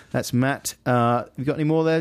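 ordinary delivery

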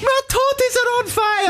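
very high, hammed-up Scottish falsetto; Missus Doubtfire. Host outdoing co-host's film impression, silly falsetto. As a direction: squeal it as a daft Scottish matron. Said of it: "Robin Williams in Missus Doubtfire".

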